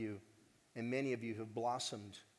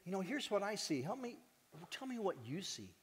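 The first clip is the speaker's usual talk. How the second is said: gentle asking; 6 semitones higher than usual. Pretend. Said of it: "I'll say".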